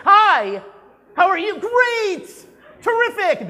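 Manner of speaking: high, loud, hyper-cheery salesperson greeting; super sugar voice. Shown as a demonstration. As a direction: gush like an overeager salesperson. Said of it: "That voice, that, like, up in the air, super sugar voice".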